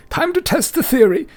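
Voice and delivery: very high, loud booming demand; hammed-up brute interrogating a baby; Pratchell demanding. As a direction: shake the answer out of him. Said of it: "He's like".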